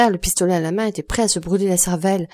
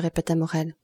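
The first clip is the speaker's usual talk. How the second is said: ordinary delivery, nothing marked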